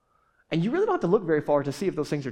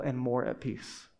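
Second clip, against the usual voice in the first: ordinary talk, nothing marked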